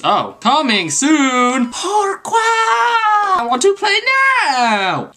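high, drawn-out whiny wail; mock dismay. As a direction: wail in hammed-up protest, very high. Games turn out to be locked.

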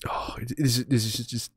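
soft breathy whisper of delight; whispered praise. Real, not acted. Streamer whispering praise to himself over his build, in a soft whisper. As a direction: whisper it like savoring a treat.

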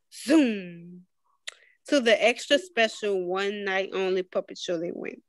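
ordinary delivery, nothing marked

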